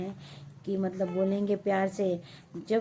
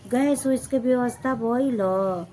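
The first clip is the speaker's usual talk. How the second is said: higher, louder mock auntie asking; imitating nosy neighbour; 5 semitones higher, 6 dB louder than usual. Neighbour woman asking about gas, sugary mock voice. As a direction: fake concern, sing-song. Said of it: "ऐसे बोलते हैं".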